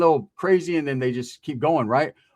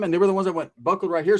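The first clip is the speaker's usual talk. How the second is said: ordinary talk, nothing marked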